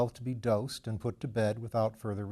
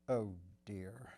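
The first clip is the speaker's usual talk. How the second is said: soft sigh under the breath; to himself; 3 semitones lower, 11 dB quieter than usual. A narrator's reading. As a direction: murmur it quietly to yourself. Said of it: "said Mister Bunter to himself".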